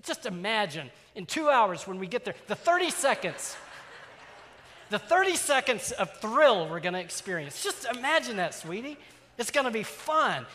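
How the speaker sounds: high, loud, overeager pitch; hammed-up enthusiasm. Father hyping the ride to a cranky five-year-old, overeager and loud. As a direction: hype the ride like a desperate salesman. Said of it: "first it goes the happy stage".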